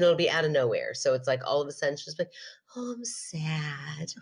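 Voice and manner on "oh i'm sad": whispered, slow, mock-pitiful sad voice; overdramatic sad child. Mother imitating daughter's fake sad whine, whispered and slow. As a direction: breathe it out like a sulky toddler after attention. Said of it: "the most overdramatic".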